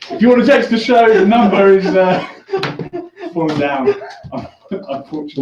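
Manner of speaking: laughter bursting between the words; breaking into laughter. Real, not acted. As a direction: try to read it out while getting the giggles. Poster falls down mid-announcement.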